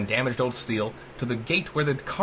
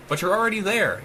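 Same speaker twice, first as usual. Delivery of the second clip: a lightly voiced shriek, pitched higher; mock shriek; 8 semitones higher than usual. A narrator's reading. The AI shrieking back at Rat's curse, higher mock shriek. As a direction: spit the taunt up high. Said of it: "it shrieked with a ring".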